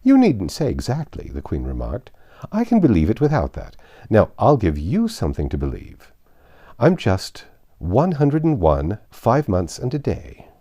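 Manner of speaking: a dithering queen chattering on. Acted. Flustered Queen talking to Alice.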